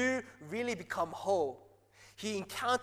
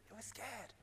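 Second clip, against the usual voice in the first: normal talking voice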